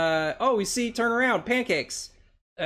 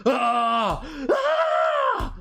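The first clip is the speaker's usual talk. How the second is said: screaming; 8 semitones higher than usual. Acted. Artist acting Reed's screams at the big monster reveal, high acted screams.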